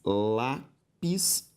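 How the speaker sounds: "lápis" very slowly split as lá, pis; long pause inside "lápis"; syllable by syllable. Shown as a demonstration. Teacher separating the word for students, syllable by syllable. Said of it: "se eu separo lápis e país".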